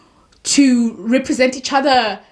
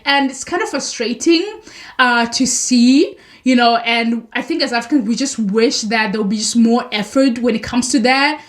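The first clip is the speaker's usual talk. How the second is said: ordinary delivery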